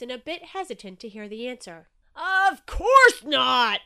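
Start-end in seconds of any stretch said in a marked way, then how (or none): 2.1-3.8 very loud, high, drawn-out roar. A narrator's reading. Shark dismissing anxious Inky's worry, roaring. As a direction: bellow it slowly. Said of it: "roared Shark".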